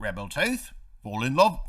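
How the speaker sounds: lightly hammed-up Cockney; a jokey stab at Cockney, rising at the ends; attempted Cockney. Scolding the rebel tooth, mock Cockney. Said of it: "Where's my Cockney at?".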